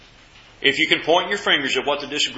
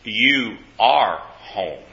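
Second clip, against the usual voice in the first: lower, very slow fatherly words; firm fatherly reply; 6 semitones lower, slower than usual. Retold or imitated. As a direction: lay it down gently but firmly, slowly. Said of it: "Daddy said".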